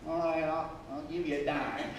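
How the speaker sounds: soft, grudging offer; grudgingly part with a coin; stingy. Acted. Stingy giver offering a dime, soft and grudging. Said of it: "A generous attitude. Instead of".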